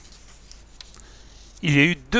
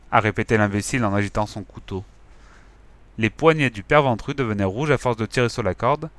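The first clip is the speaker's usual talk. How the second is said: usual speaking voice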